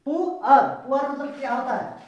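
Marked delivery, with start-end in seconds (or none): 0.1-0.6 "पू अर" loud, as long "poo" plus "ar"; syllable-by-syllable pronunciation. Shown as a demonstration. Teacher showing how we will read it, loud pronunciation demo. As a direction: model "पू अर" loudly in two beats.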